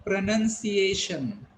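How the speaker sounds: slow, emphatic "pronunciation" with second syllable as NUN; careful teacher's model of "pronunciation"; correct model pronunciation. Shown as a demonstration. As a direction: say "pronunciation" slowly as pruh-nun-see-AY-shun. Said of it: "I repeat".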